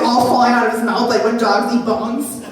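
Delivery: ordinary talk, nothing marked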